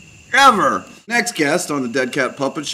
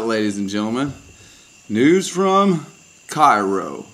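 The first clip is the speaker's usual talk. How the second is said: ordinary talk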